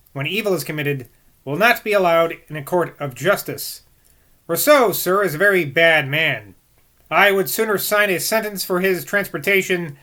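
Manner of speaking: measured, emphatic book reading; formal read-aloud. A narrator's reading. Reader voicing Johnson's verdict on Rousseau, formal read-aloud. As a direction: read it out formally with firm stresses.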